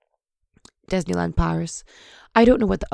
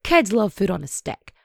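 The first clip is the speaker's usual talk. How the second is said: normal talking voice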